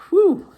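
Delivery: a loud, high whew at a bad smell; disgusted whew. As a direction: blow out at the stench. Reacting to the smell.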